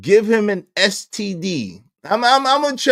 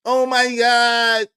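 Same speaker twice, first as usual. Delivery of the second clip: mock dismay; 4 semitones higher, much slower than usual. Real, not acted.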